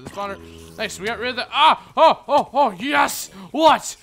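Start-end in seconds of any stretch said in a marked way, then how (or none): 1.5-3.3 excited laughing. Real, not acted. 3.5-4.0 shouting. Real, not acted. Shocked shout.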